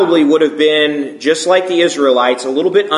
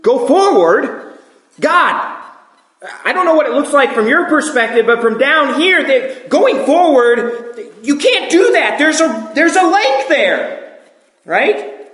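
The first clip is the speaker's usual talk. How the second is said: much higher, disbelieving objection to God; incredulous protest; 10 semitones higher than usual. Retold or imitated. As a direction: question God's order in sheer disbelief.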